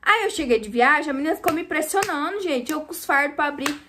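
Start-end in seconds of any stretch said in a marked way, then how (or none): none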